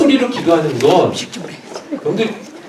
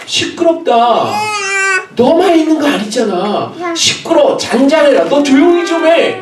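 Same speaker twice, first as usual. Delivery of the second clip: high, raised telling-off; scolding crowd; 9 semitones higher than usual. Acted. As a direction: shush the noisy man with irritation. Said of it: "주변에서 책망을 했단 말이에요".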